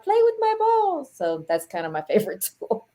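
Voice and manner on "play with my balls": high pleading sing-song plea; squeaky begging. Retold or imitated. Pleading with the spirit for activity, high squeal. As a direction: beg the ghost in a high squeal. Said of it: "every episode I'm like".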